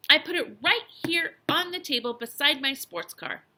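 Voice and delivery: emphatic, indignant explanation; insistent. A narrator's reading. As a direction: hit the words hard to prove it. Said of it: "insisted the boy".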